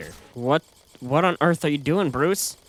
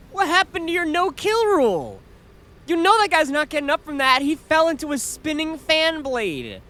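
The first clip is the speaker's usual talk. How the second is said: outraged disbelief; 9 semitones higher than usual. Real, not acted. Scolding Batman.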